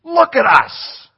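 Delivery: loud, high and slow, every word pushed; emphatic command. Retold or imitated. Preacher redoing Peter's call after praising Tom, loud and emphatic. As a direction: call it out so he must look up. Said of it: "he put some emphasis in it".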